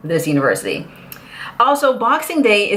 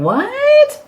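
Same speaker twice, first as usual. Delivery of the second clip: mock shock; 4 dB louder than usual. Mock reaction to free bathroom.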